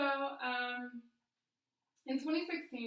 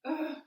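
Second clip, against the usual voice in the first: ugh; 6 semitones higher, 9 dB louder than usual. Retold or imitated. Dismay at cancer returning.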